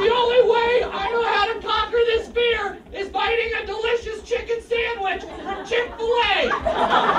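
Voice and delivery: loud, high comic terror; mock panicked screaming. Someone getting chased down by a lion, hammed-up panic. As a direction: cry it out as if fleeing for your life.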